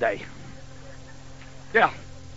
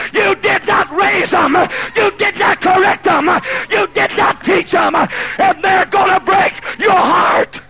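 high, loud, slow accusing shout; preaching at full force; 12 semitones higher, 8 dB louder, slower than usual. Acted. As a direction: hammer the parents' failure home.

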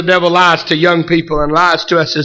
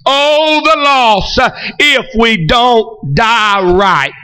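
full-force preaching; 7 semitones higher than usual. Real, not acted. Preacher warning the congregation about missing heaven, full-force preaching. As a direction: preach it hard, stretching the key words.

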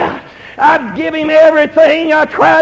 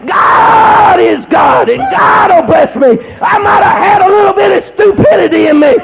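raised, driving sermon delivery; fervent preaching; 4 semitones higher than usual. Acted. Preacher testifying to congregation about burning his things, fervent. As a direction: preach it hard, stretching key words.

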